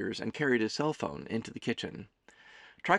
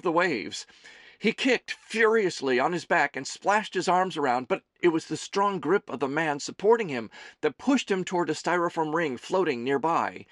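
raised, tense action storytelling; urgent story narration; 5 semitones higher, 4 dB louder than usual. A narrator's reading. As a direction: narrate a drowning scene with mounting danger. Narrates drowning dream with urgency.

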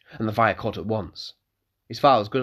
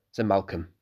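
usual speaking voice

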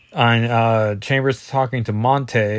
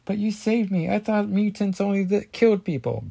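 put-on girl voice; 10 semitones higher than usual. Voicing Ace in comic.

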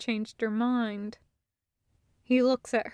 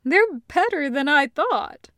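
mildly pleased verdict; 4 semitones higher, 6 dB louder than usual. Acted. Taco praising the snack cakes.

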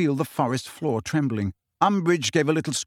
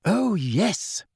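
higher-pitched, steely reply; 6 semitones higher than usual. A narrator's reading. Hermione brushing off Harry's doubt, steely. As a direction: answer with cool, steely resolve. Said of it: "in a steely voice".

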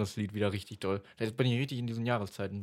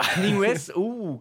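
higher and louder, astonished; can't believe the pick; shocked disbelief at the choice; surprised; 8 semitones higher, 7 dB louder, slower than usual. Real, not acted. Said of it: "was, [name] ist auf deinem Platz zwei".

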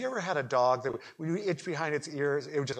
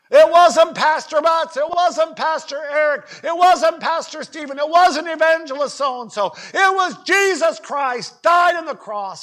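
impassioned preaching shout; 13 semitones higher, 12 dB louder than usual. Real, not acted. Preacher crediting Christ rather than the pastors, impassioned shout.

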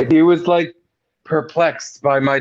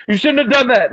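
retold screaming; 7 semitones higher than usual. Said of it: "everybody at the table screaming at me".

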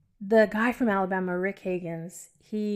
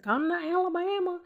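put-on thick Alabama accent; 9 semitones higher than usual. Bronx-raised woman mimics the Alabama pastor, thick Southern accent. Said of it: "really thick accent".

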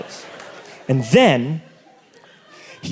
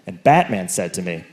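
ordinary delivery

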